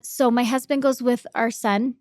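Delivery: normal talking voice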